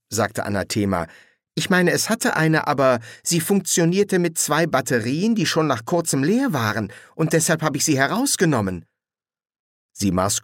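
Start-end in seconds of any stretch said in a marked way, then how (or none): 1.5-8.8 put-on high-pitched young woman's voice; young woman, uneasy. Young witch tells two strangers about her bike, uneasy.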